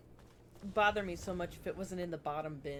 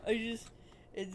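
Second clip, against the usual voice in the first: usual speaking voice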